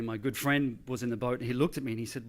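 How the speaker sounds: usual speaking voice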